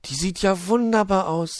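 beaming elf voice; beaming delight. A narrator's reading. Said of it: "Luzi strahlte übers ganze Gesicht".

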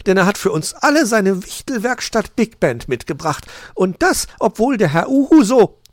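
high, loud magpie announcer; high, showy master-of-ceremonies voice; Frau Elster. A narrator's reading. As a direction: proclaim it to the whole party.

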